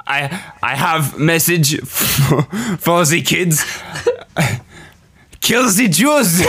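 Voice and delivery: high, slow dictator voice; Hitler voice. Acted. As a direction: drag it out, high and pleased. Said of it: "Hey, Adolf!".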